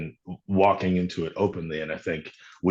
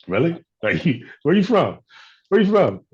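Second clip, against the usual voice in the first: rising from higher to much higher and louder, pressing the question; puzzled coworker pushing harder each time; curious staff; 9 semitones higher, 5 dB louder than usual. Retold or imitated. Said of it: "they just kinda be like".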